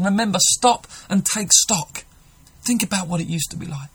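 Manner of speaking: urgent call. Acted. Preacher urging the congregation.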